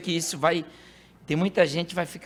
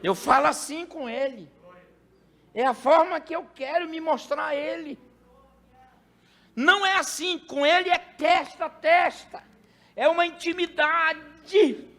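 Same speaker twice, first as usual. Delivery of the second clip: raised preaching; 10 semitones higher than usual. Acted. Voicing God's words, raised.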